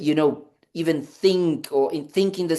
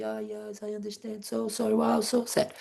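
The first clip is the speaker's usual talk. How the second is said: soft, higher-pitched consoling murmur; hushed sympathy; 5 semitones higher, 8 dB quieter than usual. Retold or imitated. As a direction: imitate yourself gently comforting someone in tears. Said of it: "people would come and cry".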